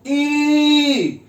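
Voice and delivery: ई drawn out slowly as a long vowel. Shown as a demonstration. Teacher showing students the long vowel, stretched ई. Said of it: "लंबा खींच रहे हैं".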